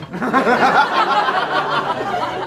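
rising burst of laughter. Real, not acted. Laughing at his mate's smuggling plan. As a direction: laugh at his own line. Laugh after fair run punchline.

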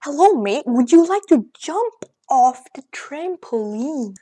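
slow, drawn-out fake British accent; mock British accent. Shown as a demonstration. Showing viewers he can nail accents, mock British accent. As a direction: put on a hammed-up British accent, stretching the words. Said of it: "Like, literally British. Like, watch this".

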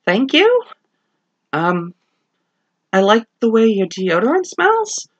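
a lightly voiced woman caught off guard; Prudence, puzzled. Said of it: "from my performance".

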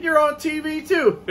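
very high, put-on fan voice; retold excited fan. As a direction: imitate a fan amazed by the friend too. Fan turns to his friend, retold.

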